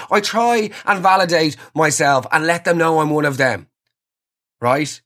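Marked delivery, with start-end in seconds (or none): none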